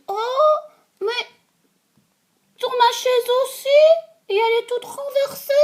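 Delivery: very high, loud little bear squeak; baby bear voice. Acted. Baby bear finding his broken chair, squeaking high. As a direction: squeak it like a tiny upset cub. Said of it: "petit ours dit".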